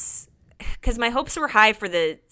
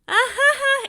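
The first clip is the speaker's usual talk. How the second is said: panicked laugh; 5 dB louder than usual. Retold or imitated. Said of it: "it was, like, a little panic".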